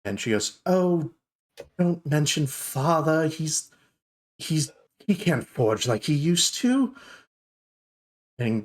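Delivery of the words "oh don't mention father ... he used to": higher, halting protest; touchy dwarf daughter. Acted. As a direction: brush off talk of father, haltingly.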